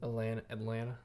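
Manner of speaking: "Atlanta" tried out as "at-LAN-uh"; soft "Atlanta" without its last T; second T dropped. Shown as a demonstration. Echoing the co-host's lesson, "Atlanta" as "at-LAN-uh". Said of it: "the T part".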